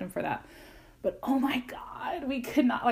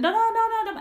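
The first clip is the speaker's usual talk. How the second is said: high, louder mock yell; imitated yelling; 12 semitones higher, 4 dB louder than usual. Sellers jumping at tourists, high mock yelling. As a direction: echo the sellers shouting over each other. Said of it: "they're yelling at you".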